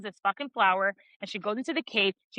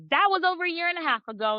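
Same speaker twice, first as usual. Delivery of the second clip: fast, high-pitched mock outrage; playfully indignant, rushed rebuke; indignant confrontation; 6 semitones higher, faster than usual. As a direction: fire off an acted accusation.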